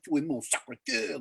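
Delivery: mock-passionate American French accent; rehearsed French, lightly hammed-up accent. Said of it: "the passion and the accent".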